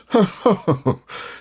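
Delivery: high, put-on careless chuckle; careless laugh. A narrator's reading. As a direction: laugh lightly as if you can't get lost. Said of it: "he gave the careless laugh".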